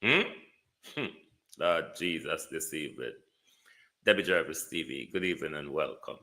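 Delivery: ordinary delivery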